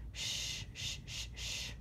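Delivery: soft, voiceless "shh" repeated; hushing shush. A narrator's reading. Librarian to noisy little bugs, hushing them. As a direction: shush them gently, finger to lips. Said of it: "put her finger to her lips".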